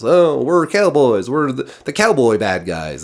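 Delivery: lightly voiced goofy cowboy villains; mock cowboys. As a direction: send up the cowboy gang. Mocking the cowboy villains' gimmick.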